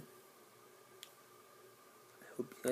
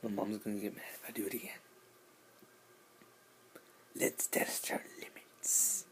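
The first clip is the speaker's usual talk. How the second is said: secret whisper. Real, not acted. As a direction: whisper like mom might hear. Whispering so mom won't hear.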